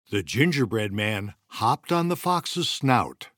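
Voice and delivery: plain reading voice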